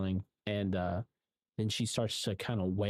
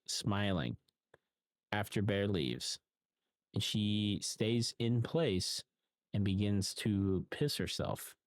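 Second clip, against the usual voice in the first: ordinary talk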